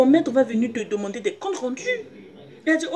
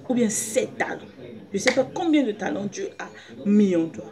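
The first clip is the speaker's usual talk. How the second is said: ordinary talk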